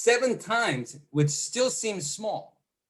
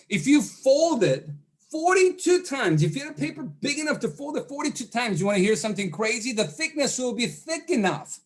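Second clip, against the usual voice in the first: growing excitement; 7 semitones higher than usual. Real, not acted. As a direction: get carried away by your own amazing fact. Building excitement about the moon claim.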